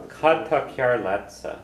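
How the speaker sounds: teacher slows down on a tricky phrase; slow model phrase. Shown as a demonstration. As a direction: spell it out slowly for the class.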